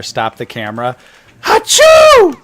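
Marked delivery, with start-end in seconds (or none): 1.4-2.4 loud high sneeze; fake sneeze. Shown as a demonstration. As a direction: blast out a sneeze. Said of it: "classic Zoo Boy".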